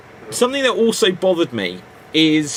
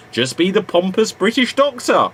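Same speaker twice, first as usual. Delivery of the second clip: ordinary delivery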